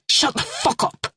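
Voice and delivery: high sharp order; snapping. A narrator's reading. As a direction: cut her off. Lee silencing Kirsty.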